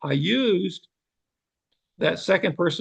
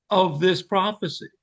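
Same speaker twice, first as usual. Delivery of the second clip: ordinary talk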